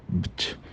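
"bt" with no voice, barely audible; a tiny voiceless puff of "bt"; som final silenciado. Shown as a demonstration. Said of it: "só que ele é silenciado". ("bt" bem baixinho, sem voz)

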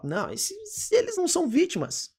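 high, quick "eles não são vítimas", mildly indignant; mock indignant retort. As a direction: scoff at the idea they're victims. (retrucando indignado, de leve)

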